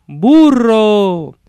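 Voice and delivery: loud, high, long-held call; echo. Acted. Echo throwing the carter's last word back, drawn out. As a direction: stretch the word out, falling away like an echo. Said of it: "el eco es todo".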